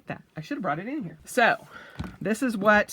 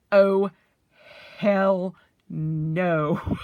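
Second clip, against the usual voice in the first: hell no; 4 dB louder, much slower than usual. Retold or imitated. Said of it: "And I'm like".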